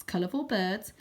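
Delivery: ordinary delivery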